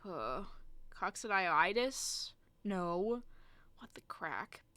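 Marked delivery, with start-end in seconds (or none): 0.9-2.3 "Coccidioides" said slowly with a rise at the end; questioning attempt. Real, not acted. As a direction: try out "Coccidioides" as an unsure question. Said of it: "too stupid to, to be able to pronounce this".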